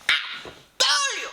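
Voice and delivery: very high, slow gloating told-you-so. Real, not acted. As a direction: draw out the victory lap. Gloating she predicted it.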